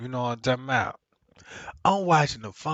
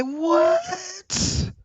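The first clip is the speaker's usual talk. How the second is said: shocked cry. Retold or imitated. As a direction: yelp in alarm. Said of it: "I said".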